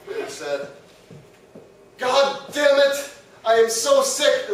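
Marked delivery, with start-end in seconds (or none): 2.0-4.5 slow, louder, higher exasperated Hitler. Retold or imitated. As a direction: bark it out as a fed-up Hitler. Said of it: "This is my Hitler voice".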